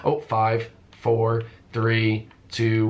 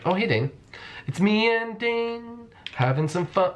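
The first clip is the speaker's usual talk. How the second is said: high silly voice; 11 semitones higher, slower than usual. Pretend. Gleeful high voice with teammate.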